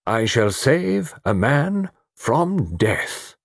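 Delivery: slow, high, portentous declaration; important. A narrator's reading. Mister Satterthwaite has his moment before the others, slow and important. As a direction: declare it grandly, word by weighty word. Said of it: "said Mister Satterthwaite importantly".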